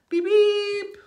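very high, loud beeping and tittering; high robot beeps. Acted. Reader doing silly sounds for the kid, high loud squeaks. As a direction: squeak it like a toy.

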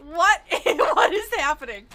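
laughing disbelief. Real, not acted. Sudden chase, laughing in disbelief.

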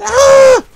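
very high, loud yelp; loud scream. Real, not acted. Player caught out mid-fight, high scream. Hit during gameplay.

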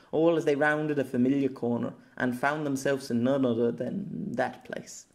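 usual speaking voice

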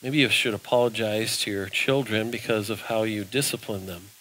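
low, soft voice of conscience; quiet Holy Spirit voice. Retold or imitated. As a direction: hush it down like an inner nudge. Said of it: "the Holy Spirit comes along and says".

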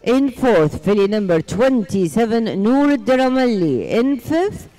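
slow, drawn-out arena announcement; booming prize announcer. Acted. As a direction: call out the winners to the arena. Announces horse show winners.

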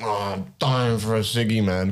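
slow, put-on smoker begging a cigarette; desperate smoker. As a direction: drag it out like a mock-desperate cadger. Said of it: "You gotta go up to".

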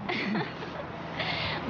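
a soft airy laugh; soft breathy giggle. Acted. Wife giggling softly at husband's joke, soft giggle. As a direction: giggle quietly at his casino joke.

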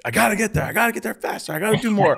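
high-pitched, worked-up imitation of growling impatience; mock frantic growling. Agent recalls urging himself on at traffic lights, mock frantic growl. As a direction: strain it up high like a frantic driver. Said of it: "growling at traffic lights and just being like".

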